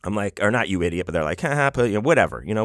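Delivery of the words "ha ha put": brief high mock laugh; mocking laughter. Friends having their fun at his expense, light mock laugh. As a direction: toss off an imitation of jeering friends. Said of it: "Everyone's laughing at me".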